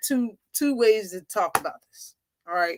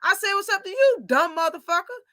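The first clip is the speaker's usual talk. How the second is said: mock angry insult; 7 semitones higher than usual. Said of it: "When Bud was like".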